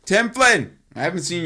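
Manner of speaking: high, loud shout-out; loud call to an absent buddy. Real, not acted. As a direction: holler a friend's name. Calls out to absent friend.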